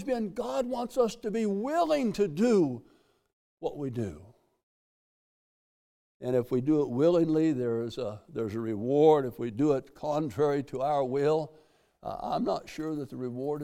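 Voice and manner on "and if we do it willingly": normal talking voice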